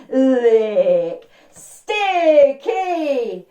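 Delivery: long slurpy lick, then a loud high stretched exclamation; drawn-out honey licking. Acted. Storyteller leads children licking sticky honey, loud and drawn-out.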